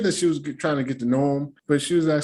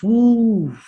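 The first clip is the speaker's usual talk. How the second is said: loud, high "whoo" exhale; big high whoo of exasperation; exasperated whoop; 9 semitones higher, 7 dB louder than usual. Real, not acted. Exasperated sound about her.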